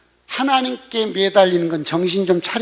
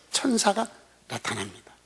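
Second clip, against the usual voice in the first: usual speaking voice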